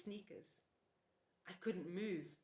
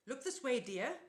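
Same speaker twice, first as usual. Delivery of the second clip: sugary scold, louder; sweetly chiding; 6 semitones higher, 7 dB louder than usual. A narrator's reading. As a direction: pull him back into line sweetly. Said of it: "Auntie Em chastised".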